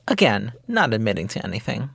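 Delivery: normal talking voice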